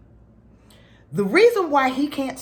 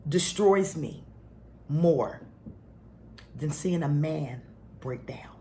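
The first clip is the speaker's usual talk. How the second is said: hushed confession; 5 semitones lower, 7 dB quieter than usual. Real, not acted. Wife telling her Divine Feminines what breaks her, hushed. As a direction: drop to a hush, lingering.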